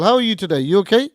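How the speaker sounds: usual speaking voice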